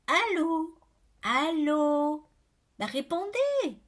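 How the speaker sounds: increasingly impatient caller; insistent phone calling. A narrator's reading. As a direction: demand an answer down the phone. Nobody answers on the phone.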